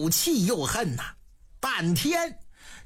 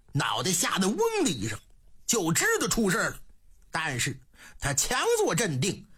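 ordinary delivery, nothing marked

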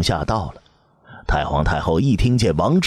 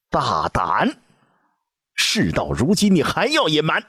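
high angry cry rising at the end; furious rebuke; 10 semitones higher than usual. A narrator's reading. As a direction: scold the liar fiercely. Empress shouting at lying eunuch.

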